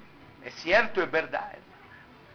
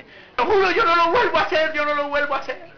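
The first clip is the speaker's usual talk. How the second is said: raised-pitch, insistent mock pleading; pleading promises; 6 semitones higher than usual. Partner swearing never to do it again, mock pleading.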